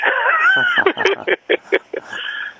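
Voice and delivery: real amused laugh; high laugh. Real, not acted. Laugh after story.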